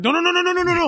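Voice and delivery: very high-pitched string of frantic denials; acted high panic; mock panicked backtracking. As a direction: squeal it like someone caught out, hammed-up. Acted frantic backtracking after a slip.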